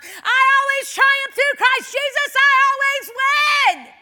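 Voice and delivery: all-out victorious yell, every word stretched; shouting it out. Acted. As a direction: bellow the verse in triumph. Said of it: "I shouted out of my mouth".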